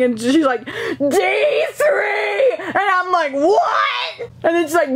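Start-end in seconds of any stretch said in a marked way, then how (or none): 0.6-2.5 high, stretched mock yell; high-pitched retold shout; screaming over music. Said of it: "they're all screaming". 3.3-4.3 very high mock-yelled question; high, straining "what?"; can't hear you. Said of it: "trying to hear what she's saying".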